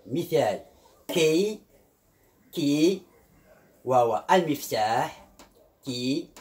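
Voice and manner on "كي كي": كي said as "kee", with a clear long ee; ee sound. Shown as a demonstration. Teacher gives the example key, ee sound. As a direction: say كي alone, ending on "ee". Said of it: "إما كإي".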